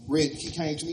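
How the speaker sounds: usual speaking voice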